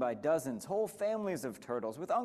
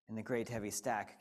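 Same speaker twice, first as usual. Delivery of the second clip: usual speaking voice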